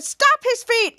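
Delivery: high, loud command; shouted order. Retold or imitated. Second elderly woman ordering attackers, shouted. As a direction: urge the attackers on.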